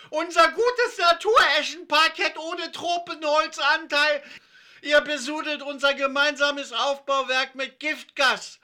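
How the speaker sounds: very high, loud, outraged yelling; furious bellowing. A narrator's reading. Christiane sees her parquet flooded, furious bellowing. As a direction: scream in outrage at the ruined floor. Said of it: "während Christiane brüllte".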